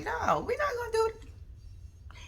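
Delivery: soft unvoiced whisper, high and slow; whispered refusal. Whispering what she'd tell Jerry, hushed no. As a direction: breathe it, a secret no.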